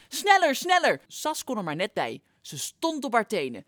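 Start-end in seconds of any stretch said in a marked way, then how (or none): 0.1-1.0 high, much louder hurry call; urgent shout. Acted. Flop urges Sas to hurry with the flag, high urgent shout. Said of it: "Flop riep naar Sas".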